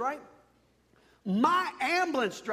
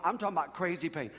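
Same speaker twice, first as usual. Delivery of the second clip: normal talking voice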